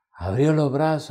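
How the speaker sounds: ordinary delivery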